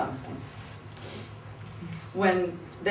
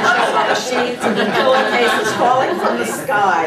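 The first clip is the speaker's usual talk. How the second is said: laughing; 5 semitones higher, 9 dB louder than usual. Real, not acted. Laughing through the punchline.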